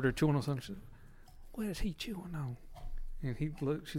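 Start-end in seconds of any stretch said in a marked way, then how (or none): 1.5-2.6 soft, slightly high Southern granny voice; quietly puzzled imitation of a Southern grandmother; Southern grandma. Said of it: "I'll give you an impression of her".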